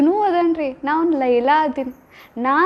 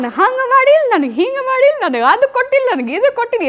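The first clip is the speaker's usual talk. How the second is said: complaining lover; 7 semitones higher than usual. Retold or imitated. Preacher mocking lovers who only complain, in a high grumble. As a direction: act out the petty complaints, pitched up.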